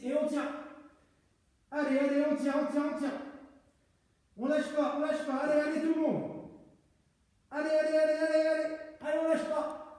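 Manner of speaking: rally the class, push them hard; coach shouting. Real, not acted. Coach pushing class to hold.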